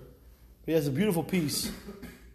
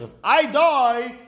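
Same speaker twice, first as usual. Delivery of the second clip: dramatic plea; 6 semitones higher, 7 dB louder, much slower than usual. Acted. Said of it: "What does that sound like?".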